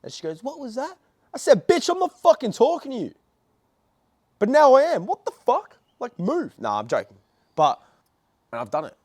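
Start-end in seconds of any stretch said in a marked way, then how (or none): 0.4-1.0 high-pitched mock old lady. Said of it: "there was an old lady". 1.7-6.5 high-pitched, loud hammed-up rant; mock angry outburst. Acted snapping at the old lady.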